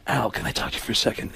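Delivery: whispered, quick aside; hushed Alec. Retold or imitated. Alec quietly calling Pacino over, whispered. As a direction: pull him aside in a whisper. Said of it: "and Alec goes".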